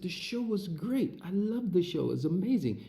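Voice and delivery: a wide-eyed fan trying to sound composed; starstruck praise. Retold or imitated.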